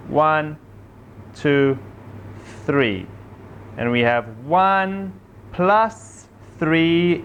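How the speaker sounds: patient sing-song counting; gentle teacher talking to small children; kindergarten teacher voice. Acted.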